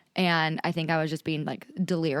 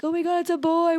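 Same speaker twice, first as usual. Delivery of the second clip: very high, excited reveal; a retold, high-pitched joyful cry; thrilled announcement; 12 semitones higher, 3 dB louder than usual. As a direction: squeal out the news of the boy. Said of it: "they're like".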